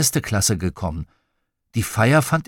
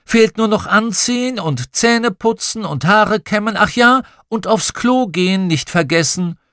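high-pitched, louder mum voice; chivvying mother; 10 semitones higher, 4 dB louder than usual. A narrator's reading. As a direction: rattle off the chores from the doorway, pitched up. Mother voiced listing morning chores.

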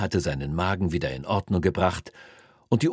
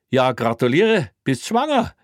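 very high, loud teasing cop; mocking joke; 7 semitones higher, 6 dB louder than usual. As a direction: rib him with a mocking joke.

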